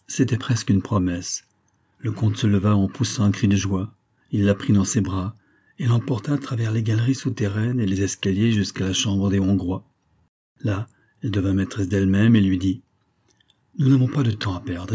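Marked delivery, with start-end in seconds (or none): none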